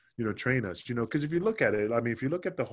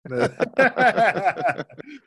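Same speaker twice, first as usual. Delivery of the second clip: loud, high burst of laughter; 12 semitones higher, 8 dB louder than usual. Real, not acted. Guest having fun with the host, real belly laugh. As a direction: crack up at your own teasing. Teasing the host about his accent.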